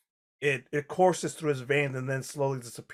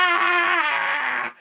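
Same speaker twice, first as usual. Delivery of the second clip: long, high, loud shriek; piercing acted victim's scream; scream of pain; 14 semitones higher, 4 dB louder than usual. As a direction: scream out as the needle goes in. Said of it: "you can hear him go".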